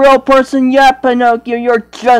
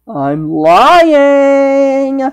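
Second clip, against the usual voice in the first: usual speaking voice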